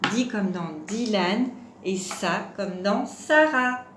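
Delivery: slow, deliberate recap, names split into syllables; révision syllabe par syllabe. Shown as a demonstration. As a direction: recite slowly, setting off each key syllable. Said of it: "On va redire les différentes syllabes". (lentement, en détachant bien les syllabes)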